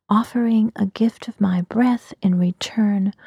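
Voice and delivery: usual speaking voice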